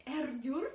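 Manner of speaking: R dur rolled loudly, with a laugh after it; hard Russian R. Shown as a demonstration. Teacher showing learners the hard R, rolled and loud. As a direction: trill a hard R hard, then laugh.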